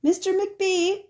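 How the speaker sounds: high, slow, drawn-out call down the hall. A narrator's reading. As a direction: call him from the floor, hurt. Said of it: "she called after a minute".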